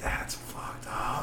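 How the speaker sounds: hushed slow whisper. Real, not acted. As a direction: breathe it out under the breath. Shocked aside at cousin joke.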